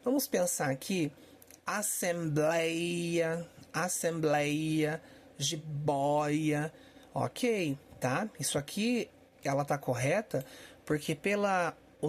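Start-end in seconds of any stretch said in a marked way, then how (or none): 1.7-6.7 assembleia and jiboia with slow, open éi and ói; open diphthongs. Shown as a demonstration. Teacher checking example words for the rule, drawn-out open diphthongs. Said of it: "Ditongos abertos".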